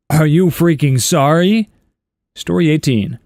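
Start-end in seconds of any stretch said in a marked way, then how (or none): 0.1-1.7 slow, gloating taunt; mock taunting. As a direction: rub it in slowly. Dad taunting after spiking ball.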